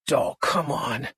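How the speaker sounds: low, slow man; a hint of a dismissive boyfriend; Buddy's voice. A narrator's reading. Buddy calming her.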